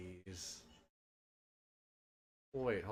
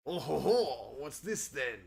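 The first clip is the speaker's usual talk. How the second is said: loud jolly pirate laugh; 5 dB louder than usual. Acted. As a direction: boom it like a pirate captain. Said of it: "I lost my pirate voice".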